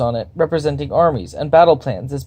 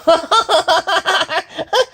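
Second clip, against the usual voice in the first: high yipping creature laugh; a strange yippy bark of a laugh. A narrator's reading. Kobold general laughing at William's demand. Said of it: "The general laughed a strange, yippy bark".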